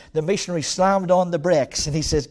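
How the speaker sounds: usual speaking voice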